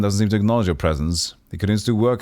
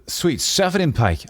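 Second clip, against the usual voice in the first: higher, a touch of a snarl; mild mock snarl; 9 semitones higher than usual. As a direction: curse it out lightly, not really growling. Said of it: "Anna snarls".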